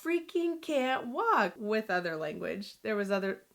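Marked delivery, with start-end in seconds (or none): 0.0-1.5 high, drawn-out mock yell; retold yell. Daughter snapping at mom who pushes her, retold yell. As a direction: re-enact a frustrated yell, slow and high. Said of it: "And then I just yelled".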